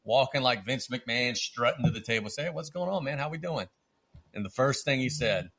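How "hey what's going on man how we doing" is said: lightly voiced cocky greeting, pitched higher; imitating cheerful swagger. Beaming manager strutting up to the table, playful imitation. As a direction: greet them like you just strutted up, grinning. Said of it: "he's smiling from ear to ear".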